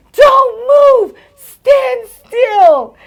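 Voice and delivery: high, loud acted-out command; retold alarm at full voice; shouted warning. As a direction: shout it urgently, stretching each command. Retold warning about a dog.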